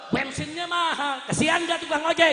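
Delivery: high, loud plea to the crowd; indignant appeal. Real, not acted. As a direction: shout it out for the drivers' sake.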